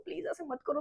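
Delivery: soft pleading, करो falling; tearful pleading. Retold or imitated. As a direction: beg softly, let करो drop. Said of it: "मैं उसके सामने भी बहुत रो रही हूँ".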